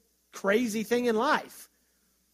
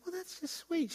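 mock sweet reply; 9 semitones higher, 8 dB quieter than usual. Retold reply to church lady.

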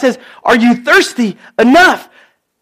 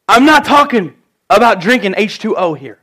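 ordinary delivery

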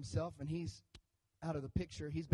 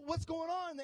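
bewildered Thomas; 9 semitones higher than usual. Retold or imitated.

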